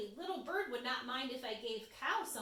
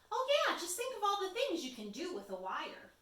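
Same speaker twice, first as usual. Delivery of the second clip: squirrel voice; 8 semitones higher, 4 dB louder, faster than usual. Acted. Squirrel persuades mouse.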